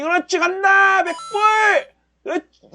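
root for the candle like a fan; cheering the market on. Real, not acted. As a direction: trader yelling at his chart to climb. Cheering profits rising.

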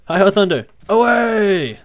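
high, loud heroic charge call; mock-heroic battle shout; rallying cry. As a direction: holler it like charging into battle, last word stretched. Mock battle cry to his mount.

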